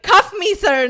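loud, high mock surrender; hammed-up eager begging; playful flirty plea. Joking flirty line to the cop.

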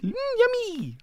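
very high, put-on yummy noise; enticing pet talk. Prisoner offers pig food, very high enticing voice. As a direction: coo as if feeding an animal.

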